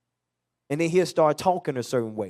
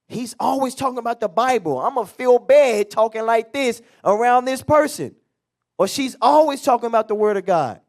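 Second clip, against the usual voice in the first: higher, louder mock coworker voice; preacher voicing coworkers at work, higher and louder; imitated coworkers; 7 semitones higher, 7 dB louder than usual. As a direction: play the gossiping coworker. Voicing coworkers talking about believer.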